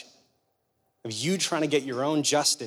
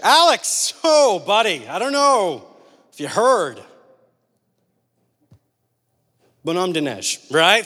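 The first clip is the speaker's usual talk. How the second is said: raised, louder call to a friend; hammed-up boy calling out to Alex; mock pleading call; 5 dB louder, slower than usual. Boy voiced in retold playground standoff.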